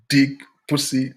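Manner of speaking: ordinary talk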